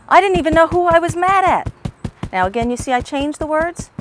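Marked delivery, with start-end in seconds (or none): 0.1-1.7 higher, louder burst of remembered anger; reliving anger. Acted. Teacher reliving her childhood anger at her father, loud and high. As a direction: let the old anger break through, raised and loud.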